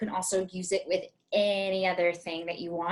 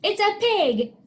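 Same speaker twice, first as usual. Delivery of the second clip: excited reveal; 11 semitones higher, 5 dB louder than usual. Shown as a demonstration.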